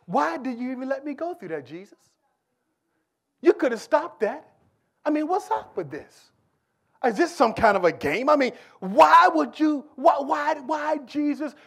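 high, loud demanding why; exasperated pleading. Retold or imitated. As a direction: rail at Jesus in mock outrage. Preacher voices complaining disciples.

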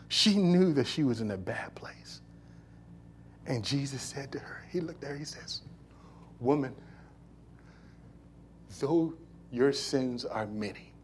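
whispered, intimate preaching; the preacher telling Jesus's words to the woman in a whisper; hushed whisper. Real, not acted. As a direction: draw them in with a whisper. Hushed narration at sermon close.

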